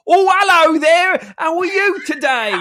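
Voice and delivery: hammed-up fake British accent, high and loud; mock British accent. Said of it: "in a British accent".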